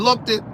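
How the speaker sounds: "looked it" in two syllables, pitched high; mock-exasperated "looked it", extra syllable and all; mispronounced looked. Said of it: "you over there saying".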